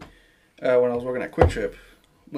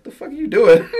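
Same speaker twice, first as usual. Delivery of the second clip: laughing disbelief; 6 semitones higher, 7 dB louder than usual. Retold or imitated. Friends' reaction retold.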